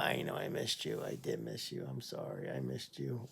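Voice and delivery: tender whispered apology to a dog; hushed pet talk. Real, not acted. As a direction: coo it under your breath to a pet you missed. Soothing her dog on return.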